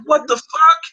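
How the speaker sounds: high, loud incredulous yell; retold outraged what the fuck; shocked shout. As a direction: blurt it out in disbelief.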